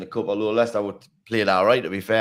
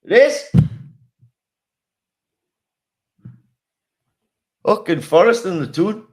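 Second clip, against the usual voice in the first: much higher and louder, bursting out; excited outburst; 7 semitones higher, 6 dB louder than usual. Real, not acted. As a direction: shout it with glee. Excited outburst about derby match.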